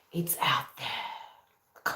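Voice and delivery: slow, drawn-out eerie whisper; mock spooky whisper. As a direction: whisper it slowly like a conspiracy believer. Mock X-Files bandwagon line.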